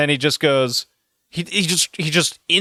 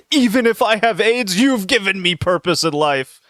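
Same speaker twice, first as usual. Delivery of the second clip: mock tearful plea; 6 semitones higher than usual. Mocking Guy's tearful plea.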